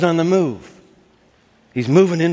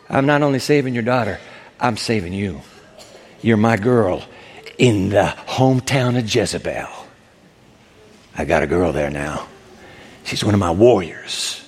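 low, intimate assurance; tender pride; 8 semitones lower than usual. Acted. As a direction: confide it proudly in a deep pitch. Jesus confiding in the woman.

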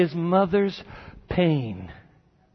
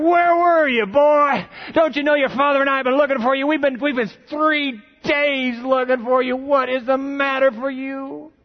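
frantic mother; 7 semitones higher than usual. Acted. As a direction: scold the runaway boy. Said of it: "an edge in the mother's voice".

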